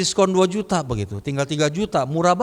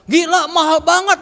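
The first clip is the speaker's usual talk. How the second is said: high, loud imitation of an appalled buyer; retold indignant outburst, pitched right up; mock outrage; 9 semitones higher, 5 dB louder than usual. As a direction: balk at the price, acted out loudly. Friend acted balking at the price.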